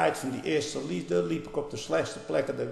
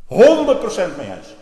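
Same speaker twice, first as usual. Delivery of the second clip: emphatic loud agreement; 6 semitones higher, 11 dB louder than usual. Real, not acted. Preacher loudly backs the quoted author, emphatic. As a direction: burst out, much louder and higher.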